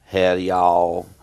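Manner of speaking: slow, loud Tennessee howdy; hammed-up East Tennessee accent. Shown as a demonstration. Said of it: "they talk like this".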